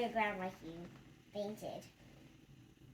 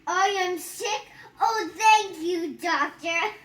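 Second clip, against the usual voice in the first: high, loud and slow, delighted; voicing the happy patient; 6 semitones higher, 17 dB louder, slower than usual. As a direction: gush the thanks like a thrilled cartoon patient.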